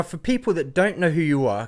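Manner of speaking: ordinary delivery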